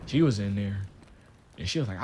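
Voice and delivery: ordinary delivery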